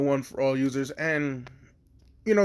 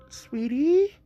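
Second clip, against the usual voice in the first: mother voice; 11 semitones higher than usual. Retold or imitated. Mom voiced.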